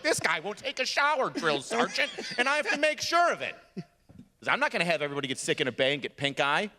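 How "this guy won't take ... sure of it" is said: high, whiny mock complaint; exasperated high-pitched griping; complaining recruits. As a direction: protest up high to the sergeant. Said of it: "thirty other grown men that are like".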